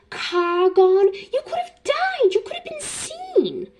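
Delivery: scolding British mother, voice climbing very high; drawn-out, badly faked British telling-off; bad British accent. Fan quoting Molly Weasley scolding from the movie, bad British accent. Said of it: "sorry for my really bad British accent".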